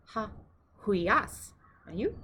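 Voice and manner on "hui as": "hui as" stressed on AS; hui-AS, end syllable strongest; stress on second syllable. Shown as a demonstration. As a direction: lean on the final "as" in "hui as". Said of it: "accent is on the second syllable".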